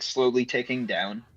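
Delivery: ordinary delivery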